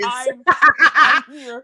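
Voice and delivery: drawn out and laughing, slow; laughing Meghan impression. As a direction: stretch it out through laughter. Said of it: "Meghan is pretty much like".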